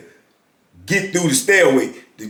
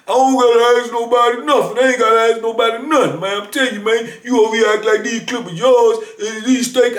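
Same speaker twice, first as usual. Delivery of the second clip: high-pitched mimicry of an angry youth; imitated heated protest, pitched well up; retold defiant outburst; 8 semitones higher than usual. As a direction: fire back as the indignant young man. Acted comeback of the defiant youth.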